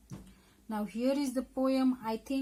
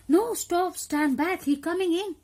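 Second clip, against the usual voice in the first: loud, high acted cry to stop; alarmed warning; 9 semitones higher, 8 dB louder, slower than usual. Warning the child that the crocodile is coming, alarmed warning. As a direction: call out a warning in bursts. Poem character warns of crocodile entering.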